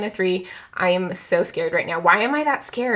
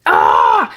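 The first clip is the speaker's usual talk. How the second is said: frustrated shout; 8 dB louder than usual. Real, not acted. Loses the card guess.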